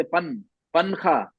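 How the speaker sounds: पनखा slowed down, full n touched; touched-tongue demo; slow wrong version. Shown as a demonstration. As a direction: sound out पन then पनखा slowly. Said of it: "अगर touch होती तो".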